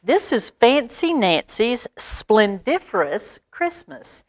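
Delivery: ordinary delivery, nothing marked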